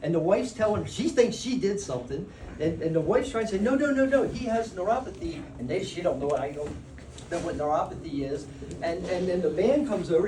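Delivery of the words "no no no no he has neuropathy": retold urgent explaining. Wife hurriedly reassuring the pedicurist.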